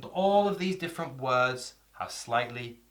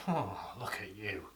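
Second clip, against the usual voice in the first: leering ogle; 5 semitones lower, 9 dB quieter than usual. Shown as a demonstration. Leering ogle example quote.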